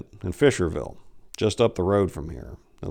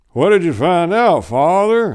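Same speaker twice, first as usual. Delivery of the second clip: put-on a worried old farmer pleading; old farmer Pickles; 10 semitones higher, 9 dB louder than usual. A narrator's reading. Mister Pickles voiced in story.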